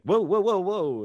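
high, loud string of whoas; urgently stopping someone. Acted. Buyer halting the dealer walking by, urgent. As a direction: flag him down before he passes.

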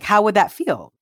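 normal talking voice

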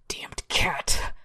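strained, forceful whisper; near whisper. A narrator's reading. Paralysed man forces words at the cat, near whisper. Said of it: "forced its way past his lips in a near whisper".

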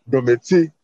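Domati in a put-on accent, low. Shown as a demonstration. Guest demonstrating his name for the host, Domati in an accent. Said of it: "let me say it in the accent".

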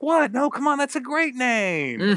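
high, slow indignant protest; a mock-outraged sitcom "what, no"; Jerry Seinfeld. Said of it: "I almost kinda sound like Jerry Seinfeld".

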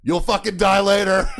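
loud, high hollered threat; acted threat yell. Instructor poking head out at hiding recruit, loud mock threat. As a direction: yell it across the squad bay, hammed-up.

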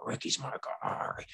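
soft, voiceless mock impersonation; Kevin. Bettor mimics a rival fan backing Ricky, mock impression. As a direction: imitate him in a hushed, put-on voice. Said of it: "Kevin's gonna watch this and say".

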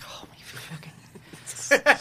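drawn-out breathless whisper of disbelief; slow stunned whisper. Retold or imitated. Reacting to a creep's remark, a stunned whisper. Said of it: "I'm like".

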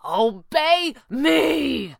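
high, slow cry, word by word; desperate shriek. A narrator's reading. Villain losing control of monsters, desperate shriek. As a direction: stretch out a desperate command. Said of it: "Pavli shrieked desperately".

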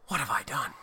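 low, soft stunned realization; dazed regret. Retold or imitated. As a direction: Nicholson realizing his mistake. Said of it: "and he goes".

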